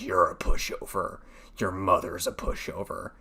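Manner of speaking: low, slow undead menace; Revenant impression. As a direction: growl it like a vengeful undead robot. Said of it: "can't do a Revenant impression".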